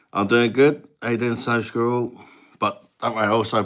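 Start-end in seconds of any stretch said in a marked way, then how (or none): 0.1-2.1 put-on light, louder Indian-accent reply; Rundy's Indian accent. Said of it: "an Indian guy called Rundi".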